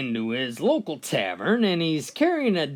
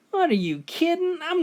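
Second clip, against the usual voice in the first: incredulous; 12 semitones higher than usual. Acted. Bartender outraged at dog trick.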